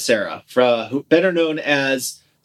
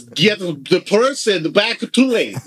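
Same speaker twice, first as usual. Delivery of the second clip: Van Damme broken English; 6 semitones higher than usual. Retold or imitated. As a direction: ham up a thick foreign accent on a dumb one-liner. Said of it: "Yakov Smirnoff".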